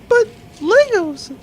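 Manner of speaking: high, slow, whiny delight; excited child pleading; childish glee. Acted. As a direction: squeal it like a kid denied toys. Childish outburst over stolen Legos.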